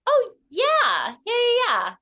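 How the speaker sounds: high-pitched, too-quick yeses imitating friends; breezy reassurance. Friends dodge questions about feeding hamsters, high breezy reassurance. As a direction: brush off the question in a high mock voice.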